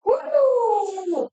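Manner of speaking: sudden high whoop; surprised whoop. Real, not acted. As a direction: yelp at the heat. Taking hot muffins from oven.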